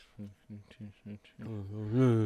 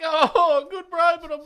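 much higher, louder Kiwi mate voice; imitating Willie; 10 dB louder than usual. The Kiwi mate warning that his family hears the speakerphone, in a high put-on voice. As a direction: squeak it out like an embarrassed mate. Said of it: "And he's like".

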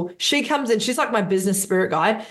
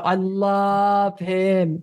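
tender gush; much slower than usual. Real, not acted. Gushing about mentor.

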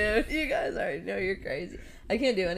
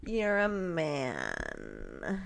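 impression; 6 semitones lower, 7 dB quieter, much slower than usual. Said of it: "his impression of Halima".

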